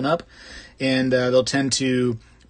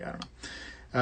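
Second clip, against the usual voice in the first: unsure whisper; 15 dB quieter, slower than usual. Real, not acted. Musing aloud, trailing off.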